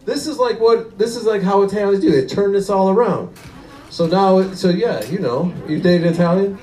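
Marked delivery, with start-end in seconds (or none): none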